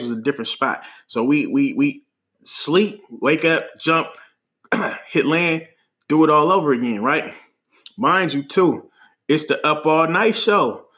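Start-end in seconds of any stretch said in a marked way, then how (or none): none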